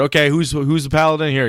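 rally the table to find the paladin; eager player. Retold or imitated. Player calling out to the party, excited.

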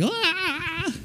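high, soft strangled "ah"; mock wail. Mock confusion over tenses.

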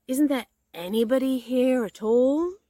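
loud, high pleading call. A narrator's reading. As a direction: project the question down a hole. Pooh calling into the hole again.

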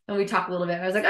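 normal talking voice

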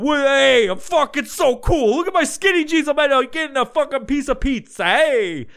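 loud, high, boastful Guido caricature; mock Guido accent. Skinny-jeaned pizza guy showing off, boastful Guido accent. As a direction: brag in an over-the-top New York accent. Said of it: "You don't fucking talk like that".

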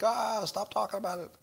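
whiny subscriber. Retold or imitated. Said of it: "you're like".

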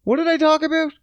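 high, baffled squeak of a question; confused self-quote. Retold or imitated. Comic asking himself what he just said, high and baffled. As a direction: pitch way up, lost. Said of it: "and I'm like".

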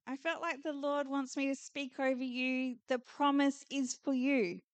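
ordinary talk, nothing marked

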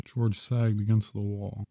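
usual speaking voice

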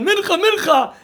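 high, loud street call for a minyan. Retold or imitated. Man in the street calling for a prayer quorum, loud call. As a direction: call out Mincha to passers-by.

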